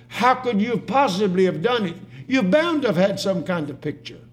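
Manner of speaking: high, loud amazement; overwhelmed, high-pitched exclamation; astonished outcry. Acted. Parents amazed at daughter's portrait.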